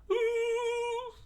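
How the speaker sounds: very high sung ooh; pained ooh. Acted. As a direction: wince out loud. Crowd reacts to hit.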